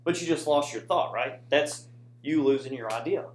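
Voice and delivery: ordinary delivery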